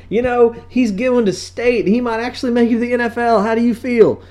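high, laughing, eager; mock enthused neighbor; excited dad friend. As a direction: gush over the son. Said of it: "it'll be like".

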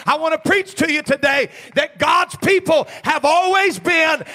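loud, driving pulpit cadence; full-force preaching. Acted. Pastor preaching loudly to his church about separation. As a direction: preach it hard to the congregation.

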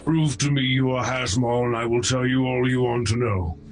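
usual speaking voice

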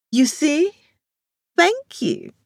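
very high, gloating told-you-so. A narrator's reading. Amaka gloating over the vote to Latoya, very high. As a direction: rub it in. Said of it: "Amaka gloated".

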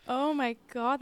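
ordinary delivery

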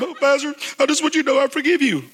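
high, fast, sugary mock voice; confused churchgoer. Pastor mimicking a confused member forgiving him, high and fast. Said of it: "they come up".

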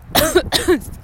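sudden dry cough; a real cough caught mid-talk; coughing fit. Real, not acted. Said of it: "I'm just coughing on my own saliva".

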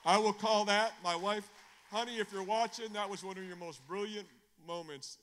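loud, high shout to his wife; loud praise shouted to the wife watching; excited shout-out. Real, not acted. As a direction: call out to her with delight.

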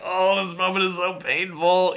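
high-pitched mock-pained lament; mock anguish. Speaker recalling an awkward silence to listeners, mock anguish. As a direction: wail it lightly, high, for comedy.

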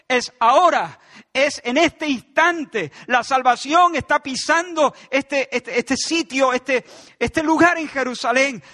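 loud, urgent preaching, pressing every word; full-force sermon delivery; fiery preaching. Acted. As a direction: drive it home to the congregation.

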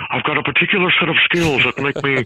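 channel Liam Neeson's calm menace; Liam Neeson impression. Guest showing off his Liam Neeson voice to the host. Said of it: "even Liam Neeson".

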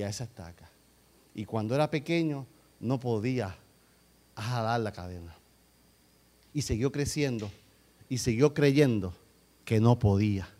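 low, softened storytelling with pauses; relato solemne. Real, not acted. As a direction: bring it right down and tell it gravely. Preacher drops to hushed storytelling. (narración grave, baja y pausada)

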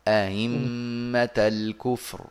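أئمة الكفر recited slowly with the second hamza softened; slow teacher's demo of أئمة eased. Shown as a demonstration. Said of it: "هكذا". (تسهيل الهمزة في أئمة)

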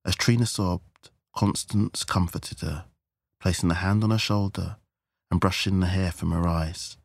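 plain reading voice

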